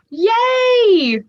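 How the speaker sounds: high, drawn-out squeal of joy; gasp, then cheer high; delighted cheer. Real, not acted. Delighted reaction to stream chat.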